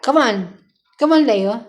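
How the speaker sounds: ordinary delivery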